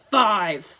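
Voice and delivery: loud monster order; monster ultimatum. A narrator's reading. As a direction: decree it firmly as a monster. Cyclops haggles over days.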